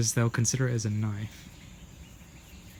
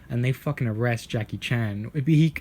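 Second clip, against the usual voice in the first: ordinary talk, nothing marked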